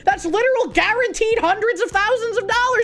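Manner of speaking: high-pitched imitation, climbing to the last question; mock incredulous. Streamer mimicking women defending OnlyFans, high mock incredulity.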